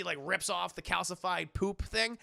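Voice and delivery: usual speaking voice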